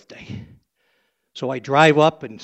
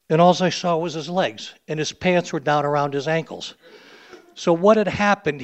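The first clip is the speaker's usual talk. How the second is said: ordinary delivery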